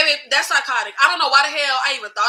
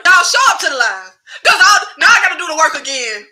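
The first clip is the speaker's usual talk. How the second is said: loud, raised-voice plea to the audience; half-laughing loud complaint; exasperated demand; 3 semitones higher, 9 dB louder, faster than usual. Real, not acted. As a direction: insist loudly, on the edge of laughter. Vents over redoing lost work.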